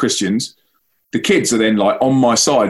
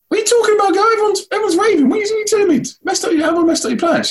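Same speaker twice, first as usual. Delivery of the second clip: baffled disbelief; faster than usual. Retold or imitated. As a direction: act utterly confused by her claim. Baffled reply to girl.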